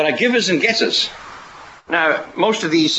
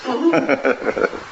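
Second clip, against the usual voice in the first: amused laugh; 12 semitones higher than usual. Real, not acted. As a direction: laugh in a high-pitched chuckle. Laughs at his own joke.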